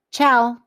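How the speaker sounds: ciao said as a quick bright goodbye; Italian-style ciao. Shown as a demonstration. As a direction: toss off a cheerful ciao. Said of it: "goodbyes in different languages".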